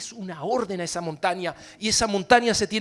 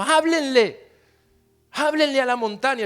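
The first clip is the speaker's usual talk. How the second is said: loud and higher command; forceful command; 6 semitones higher, 5 dB louder than usual. Acted. Pastor voicing Jesus commanding the disciples, forceful. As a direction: urge them to speak.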